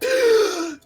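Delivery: sharp, loud intake of breath; deep gasp. Acted. Balmir's throat snaps back together, deep relieved gasp. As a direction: suck in air as the throat opens. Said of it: "take this deep breath".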